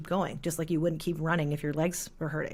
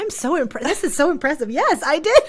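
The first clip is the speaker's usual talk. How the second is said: laughing; 11 semitones higher, 8 dB louder than usual. Real, not acted. Surprised the host did research.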